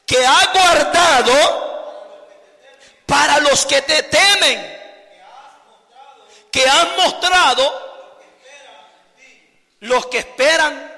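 slow, loud psalm reading; solemn recitation. Acted. Preacher proclaiming the psalm to the church, slow and loud. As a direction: proclaim each phrase slowly.

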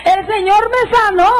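high, slow cry of joy; slow high mock rapture; retold ecstatic cry. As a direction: stretch it out, high and trembling. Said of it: "se meneaba y temblaba".

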